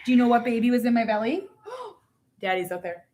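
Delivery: usual speaking voice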